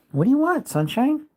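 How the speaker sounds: high cooing voice for the puppy; high sing-song pet talk. Real, not acted. Baby talk to dog.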